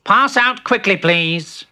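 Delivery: high, loud official call; brisk order. Acted. Mole emptying the lift, brisk high order.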